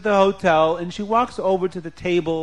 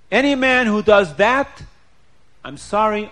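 indignant wife; 5 semitones higher, 7 dB louder than usual. Acted. Wife renounces her husband.

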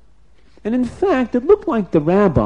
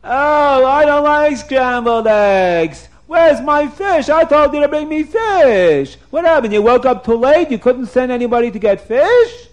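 hammed-up royal fit over breakfast; outraged king; 9 semitones higher, 7 dB louder than usual. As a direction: wail and rage like a thwarted king. King outraged at scrambled eggs.

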